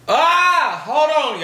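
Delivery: high, loud wail to let it out; real cry bursting out mid-sentence; tearful outburst. Real, not acted. Breaking off advice to get the tears out, tearful outburst. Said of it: "I had to get it out".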